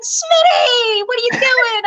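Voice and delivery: high, drawn-out questioning call. Retold or imitated. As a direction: imitate a sergeant hollering a question, amused. Sergeant calling out in disbelief.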